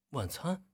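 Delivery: wondering 晚餐, asked not stated; questioning. Shown as a demonstration. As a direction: echo 晚餐 in puzzlement, pitch lifted. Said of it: "有点带疑问的感觉".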